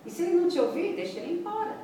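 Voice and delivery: high-pitched, emphatic shrug-off; lively, dismissive advice to an anxious speaker; animated dismissal. Acted. Dismissing an imagined impatient listener.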